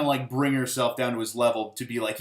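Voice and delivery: ordinary talk, nothing marked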